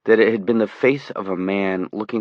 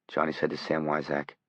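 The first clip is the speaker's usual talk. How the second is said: plain reading voice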